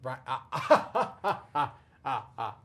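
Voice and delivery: laughing. Real, not acted. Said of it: "laughing at team name".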